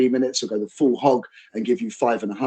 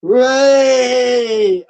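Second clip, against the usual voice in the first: higher, louder cheer breaking through; reluctant hooray; 11 semitones higher, 8 dB louder, much slower than usual. Retold or imitated. Adults finally cheering at the panto, won over. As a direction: give in and cheer. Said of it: "until these adults go".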